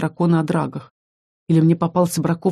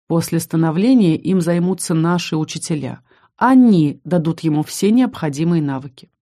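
plain reading voice

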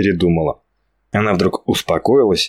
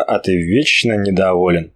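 ordinary delivery

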